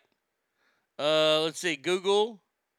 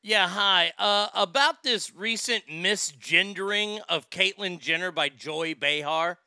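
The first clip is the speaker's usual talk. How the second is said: ordinary talk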